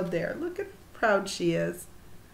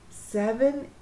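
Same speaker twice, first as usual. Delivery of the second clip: plain reading voice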